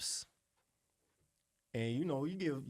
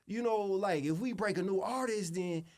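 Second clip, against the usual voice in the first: high, slow mock exec voice, louder; mimicking the exec; 8 semitones higher, 5 dB louder than usual. Producer mocking the exec's sales pitch, pitched high. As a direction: ham up the label guy's pitch. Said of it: "he said".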